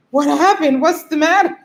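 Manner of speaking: high, urgent imitation of concern; acted worry, pitched well up. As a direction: imitate someone alarmed for a friend. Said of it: "he's concerned".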